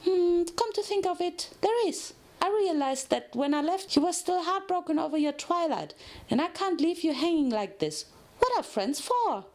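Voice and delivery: high bubbly pony voice; cheery cellmate offering support; Pinky voice. Acted. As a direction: lift the voice way up, chirpy and eager. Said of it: "said Pinky".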